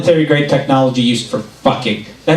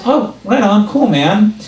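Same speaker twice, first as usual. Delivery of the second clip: laid-back employee; 6 semitones higher, slower than usual. Retold or imitated. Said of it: "And he just goes".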